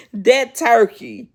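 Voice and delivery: slow, laughing through the words. Real, not acted. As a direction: still laughing at that turkey. Laughing at the turkey.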